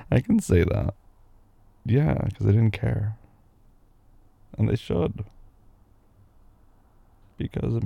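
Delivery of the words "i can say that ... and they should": usual speaking voice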